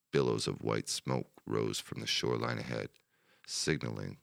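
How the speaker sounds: plain reading voice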